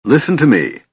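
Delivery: high, pressing appeal; urgent plea to be heard. A narrator's reading. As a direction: demand attention urgently. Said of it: "Silvara said urgently".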